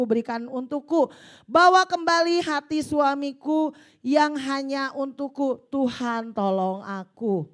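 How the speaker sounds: loud, impassioned prayer voice read to the congregation; fervent prayer reading. Acted. Preacher reading a wife's prayer aloud, fervent and loud. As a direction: cry out the prayer like a battle cry.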